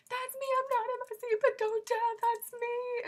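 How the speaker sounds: high, loud, frantic fan yell; retold yelling. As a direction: yell it across the rows to be noticed. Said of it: "yelling at them".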